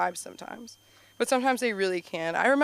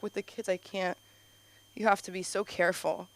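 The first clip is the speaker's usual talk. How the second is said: usual speaking voice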